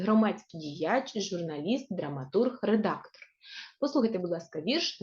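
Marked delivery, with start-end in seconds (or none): none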